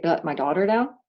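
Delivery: normal talking voice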